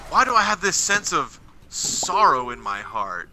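usual speaking voice

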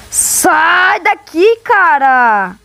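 yelling. Real, not acted. Yelling at monster in game.

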